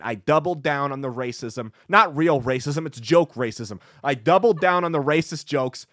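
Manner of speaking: normal talking voice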